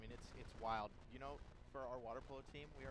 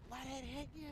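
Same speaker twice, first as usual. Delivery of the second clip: put-on high-pitched kid's yell from second base; retold yell; 13 semitones higher, 4 dB louder, slower than usual. Buddy yells at batter from second base, high mock yell. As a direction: yell it out, imitating a Little League kid. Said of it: "who just yells at him".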